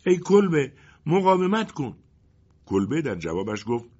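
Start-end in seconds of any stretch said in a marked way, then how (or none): 0.1-2.0 high, loud desperate shout. Acted. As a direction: cry out to the hut to hold on. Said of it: "پسرک فریاد کشید".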